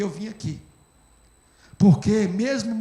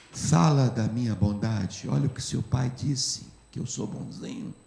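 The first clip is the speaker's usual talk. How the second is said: voice of Jesus; 9 semitones lower, 7 dB quieter than usual. Retold or imitated. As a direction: speak as a gentle divine voice, dropping away. Jesus voiced speaking to him.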